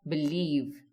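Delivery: "believe" with the weight on "lieve"; short "be", long "lieve" in "believe"; stress on second syllable. Shown as a demonstration. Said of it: "apostrophe before the stress syllable".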